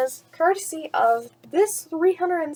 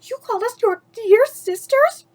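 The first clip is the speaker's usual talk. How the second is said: much higher, louder panicked question; backing toward the door scared; frightened disbelief; 10 semitones higher, 7 dB louder than usual. Acted. Stepsisters frightened by Cinderella.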